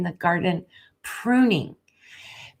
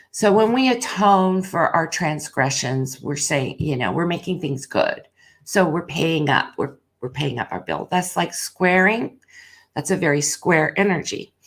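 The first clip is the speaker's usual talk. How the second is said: usual speaking voice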